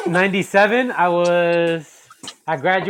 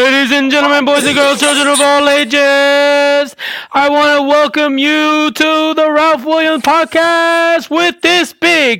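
booming ringmaster welcome, high and loud; 9 semitones higher, 5 dB louder than usual. Acted. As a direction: announce it like a circus ringmaster. Ringmaster intro for the podcast.

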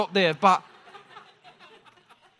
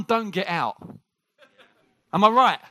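usual speaking voice

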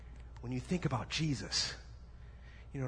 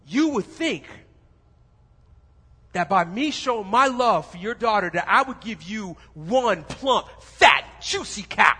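loud, higher, worked-up prince; hammed-up outrage at one fat cow; mock indignant prince; 6 semitones higher, 11 dB louder than usual. Prince scoffing at the father, loud and higher. Prince mocking the dad's expectation.

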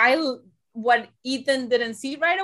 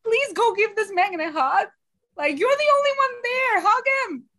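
high pleading plea to the TV; 10 semitones higher, 3 dB louder than usual. Retold or imitated. Cohost begging Doctor Charles to hug Ethan, high plea. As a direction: beg him, voice way up. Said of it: "I was like".

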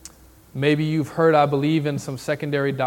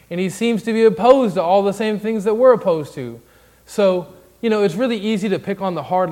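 louder and higher, building each line; rising preaching fervor; 5 semitones higher, 5 dB louder than usual. Acted. Pastor to the church, rising preaching fervor.

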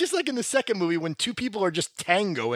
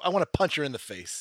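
usual speaking voice

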